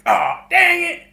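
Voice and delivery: exasperated dad. Retold or imitated. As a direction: grumble in embarrassment about the neighbours. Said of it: "your dad was just like".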